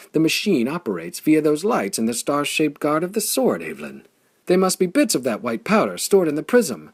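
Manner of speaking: high, loud joyful exclamation; elated loud cry; delighted cry. A narrator's reading. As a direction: shout it out in sheer delight. Flayn delighted explaining discovery.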